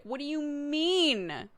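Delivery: high, drawn-out mock whine; indignant toddler. Toddler mad at being asked to repeat it, indignant whine. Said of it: "that makes them mad".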